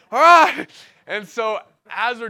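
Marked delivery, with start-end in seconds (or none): 0.1-0.7 loud, high whoop of agreement; hyped-up shout. Retold or imitated. Runner agreeing to a half marathon, excited shout. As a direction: burst out with mock gung-ho gusto. Retold eager agreement to run.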